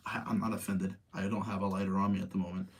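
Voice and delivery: normal talking voice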